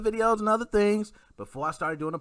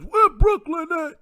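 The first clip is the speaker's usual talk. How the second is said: high, slow shouted hype call in a borrowed rapper voice; loud mock rapper shout-out; 12 semitones higher, 6 dB louder, slower than usual. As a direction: belt it out like a rapper hyping the crowd. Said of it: "I can't do the [name] voice".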